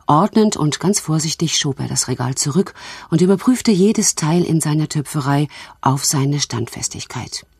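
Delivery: usual speaking voice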